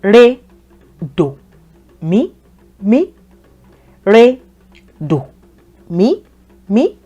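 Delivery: "Re, do, mi, mi" as separate tones; tone drill. Shown as a demonstration. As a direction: drop on do, swoop up on each mi. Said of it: "Try to pronounce after me".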